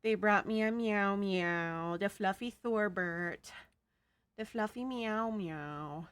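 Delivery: put-on slow cooing baby talk; cute anime streamer. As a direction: coo over the fluffy cat slowly. Streamer coos over the cat.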